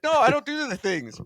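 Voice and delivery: high-pitched mock denial; comic defensive protest, way up in pitch; Jesus protesting. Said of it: "He's like".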